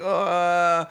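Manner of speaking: high mock sob; a high hammed-up little cry; imitating a crying toddler. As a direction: whimper it like a small kid crying in a stall. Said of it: "He's like".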